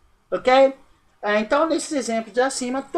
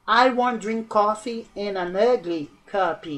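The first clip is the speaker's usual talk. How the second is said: exemplo lento; slower than usual. Shown as a demonstration. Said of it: "por que que eu usei N aqui?".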